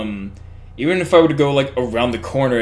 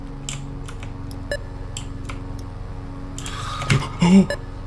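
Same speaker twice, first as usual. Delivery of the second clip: sharp gasp; 5 semitones higher, 9 dB quieter than usual. Real, not acted. Creeping dark, tension rising.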